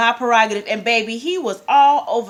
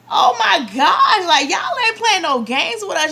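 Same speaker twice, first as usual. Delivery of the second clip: thrilled reaction; 9 semitones higher, 6 dB louder than usual. Retold or imitated. Fan reliving the oiled-up singer's entrance, thrilled. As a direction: gush it high and loud, stretching the exclamation.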